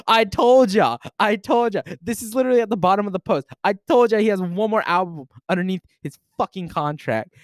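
high, excited crowing; gloating I told you. Real, not acted. Streamer crowing at chat that he predicted the album. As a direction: rub it in that you called it.

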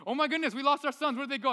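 playing the frantic parent who lost her kids; hammed-up hide-and-seek panic; imitating Christina. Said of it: "I always do it like Christina".

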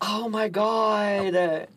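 high, very slow, drawn-out exclamation; exasperated disbelief. Real, not acted. Guest amazed at her own forgetting, long high exclamation. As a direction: stretch every word in disbelief.

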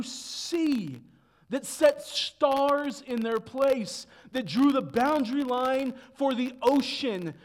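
loud, high, building pulpit proclamation; full-force preaching. Real, not acted. Preacher proclaims God's saving power to congregation, full-force preaching.

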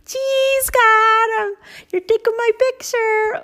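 put-on high, loud little girl voice; a thrilled little girl posing; girl's voice. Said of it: "this little girl stopping and smiling".

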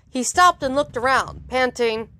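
ordinary delivery, nothing marked

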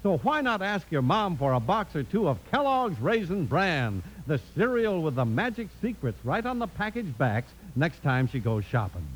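normal talking voice

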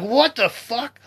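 high, loud what the fuck; shocked, indignant outburst; outraged disbelief. Retold or imitated. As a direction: yell it in stunned disbelief. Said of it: "I was like".

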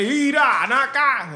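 mock Mexican slang. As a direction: put on a Mexican accent badly. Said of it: "un venezolano que dice". (venezolano imitando el acento mexicano)

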